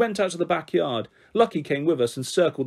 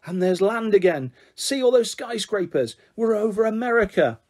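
excited; 6 semitones higher than usual. Acted. Girls pretending to fly on swing.

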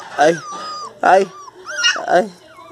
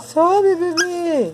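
very high, pleading cheer; much slower than usual. Real, not acted. Parent urging the baby to climb, high coaxing.

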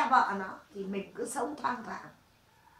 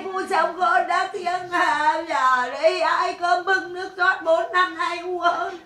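slow, high, loud mock lament, hammed up; mock fake wailing; 6 semitones higher, 5 dB louder, slower than usual. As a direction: parody a fake funeral lament, dragging every word. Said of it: "khóc giả tạo". (khóc than giả vờ, kéo dài và lên giọng)

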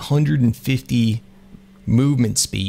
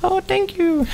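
much higher, squeaky little voice; a coy female creature, giddy. Acted. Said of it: "He goes".

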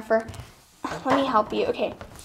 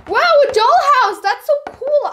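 excited admiration; 8 semitones higher, 4 dB louder than usual. Acted. Admiring the gift.

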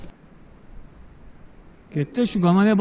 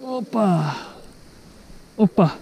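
usual speaking voice

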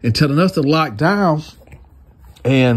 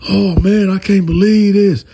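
raised, higher mock yell of disbelief; retold yelling; 7 semitones higher, 4 dB louder than usual. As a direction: yell it out in disbelief, half-acted. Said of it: "yelling at this point".

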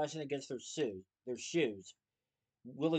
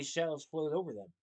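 ordinary delivery

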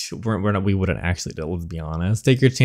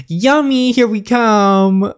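very high, loud playful voice; hammed-up eagerness; 10 semitones higher, 6 dB louder, slower than usual. Captive girl about to bite the vampire's neck, hammed-up eagerness. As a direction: sing out gleefully before a bite.

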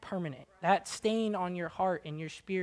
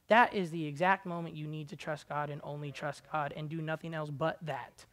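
normal talking voice

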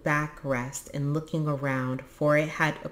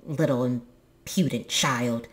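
put-on grumpy old stork voice; testy character voice; scolding stork; 2 semitones lower than usual. A narrator's reading. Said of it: "scolding to himself".

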